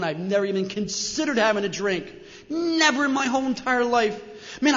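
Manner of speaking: normal talking voice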